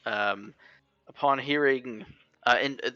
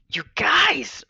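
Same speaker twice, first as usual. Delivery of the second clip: whispering; 7 dB louder than usual. Acted. Character whispering to friends.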